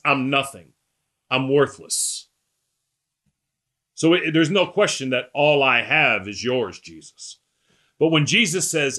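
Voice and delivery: usual speaking voice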